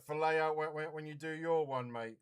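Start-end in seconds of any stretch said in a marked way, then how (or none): none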